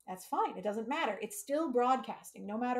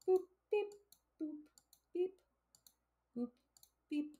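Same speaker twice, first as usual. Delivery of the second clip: playful robot beeps; 7 semitones higher, 3 dB quieter than usual. Real, not acted. Playful noises after success.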